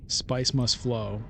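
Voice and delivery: ordinary talk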